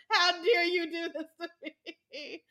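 slow, high wail through laughter; mock betrayal. Fan re-enacts crying at the show, slow mock betrayal. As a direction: draw it out, hammed-up and wounded. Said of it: "I was like".

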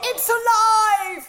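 high, drawn-out triumphant cry; hammed-up monster-movie proclamation; mock mad scientist. Mock horror-film cry of revival.

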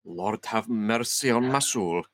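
slow Scottish prayer, lightly aged; older Scottish. Acted. Maid praying over the body, slow Scottish accent. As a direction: pray slowly in a Scots voice. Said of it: "Because I've heard you do the Scottish accent".

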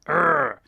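loud growled "urgh"; grunt of frustration. Acted. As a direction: let out a rough, loud grunt. Voicing a gut feeling left over.

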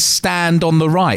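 slow, spelled-out sign reading. Retold or imitated. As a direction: drag out stand on the right. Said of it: "a big fucking sign that says".

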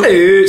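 loud held cow-like note; jingle moo. Acted. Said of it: "And the jingle goes".

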